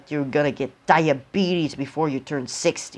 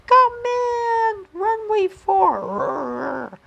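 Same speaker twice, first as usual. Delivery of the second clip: airplane radio voice; 4 dB louder, much slower than usual. Acted. Hypnotized boy plays an airplane.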